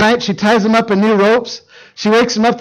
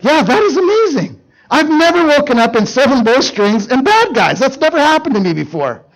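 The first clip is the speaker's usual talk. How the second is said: higher, hammed-up amazement; amazed Samson; 6 semitones higher than usual. Samson to Delilah after beating the Philistines, hammed-up amazement. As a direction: marvel like an overexcited strongman.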